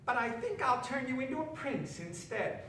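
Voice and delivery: the witch teasing a planned trick. A narrator's reading. Witch toying with Jerome, high and sly. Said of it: "the witch said".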